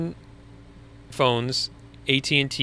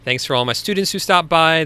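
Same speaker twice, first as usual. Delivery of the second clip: usual speaking voice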